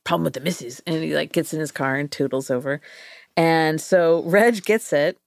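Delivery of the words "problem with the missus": put-on quick, offhand British accent; a mate's quick British aside. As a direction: toss it off in a chummy British accent. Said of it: "Reg is like".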